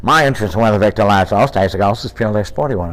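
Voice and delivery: fast, clipped Bogart movie line; nailed Bogart impression. Shown as a demonstration. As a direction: rattle it off like Bogart himself. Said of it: "Oh my God, I got it".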